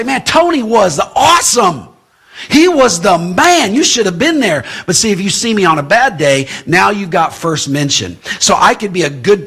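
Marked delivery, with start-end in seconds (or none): 0.0-4.6 excited praise. Retold or imitated. Churchgoer raving to friends about the preacher, excited. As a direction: gush like a fan who just heard him. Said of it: "you're gonna go out and say".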